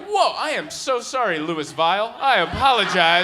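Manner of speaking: loud, high, over-emphatic apology; hammed-up flustered sorry; startled apology. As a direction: apologize as if backing away from a mob. Startled apology to angry crowd.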